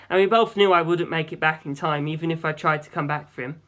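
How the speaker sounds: ordinary delivery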